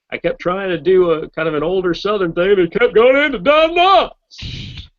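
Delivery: loud, high Southern character voice; Don Knotts. Shown as a demonstration. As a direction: start older Southern, climb into a loud, drawn-out high pitch. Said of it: "I kept going into Don Knotts".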